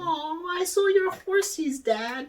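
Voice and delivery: put-on high-pitched cutesy little kid; put-on squeaky child voice. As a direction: tell dad sweetly in a tiny high voice. Mimics childhood self to dad.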